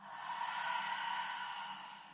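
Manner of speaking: long, airy exhale through the mouth; long sigh out. Shown as a demonstration. Teacher showing how to exhale out the mouth, long sigh. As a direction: sigh the whole breath out. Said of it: "sigh out the mouth".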